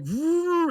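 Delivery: high, loud imitated engine roar; imitating the boat flooring it; engine vroom. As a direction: rev it like a boat motor. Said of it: "So I was like".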